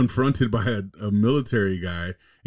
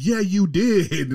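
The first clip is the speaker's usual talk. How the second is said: slow, higher mock wife insisting; 9 semitones higher, 3 dB louder, much slower than usual. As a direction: stretch it out high like the stubborn wife. Wife insisting in retold video.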